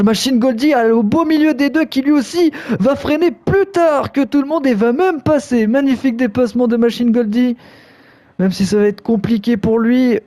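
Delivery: rising thrill as the overtakes land; motorsport commentator. Real, not acted. Live overtakes in a race.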